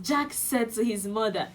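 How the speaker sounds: ordinary delivery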